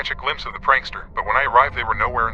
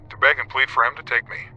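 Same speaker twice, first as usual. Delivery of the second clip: usual speaking voice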